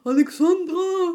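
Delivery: long loud call across the street; shouted name. Retold or imitated. Stranger yelling her name from a car, loud call. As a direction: yell the name from a passing car. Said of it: "und schreit so".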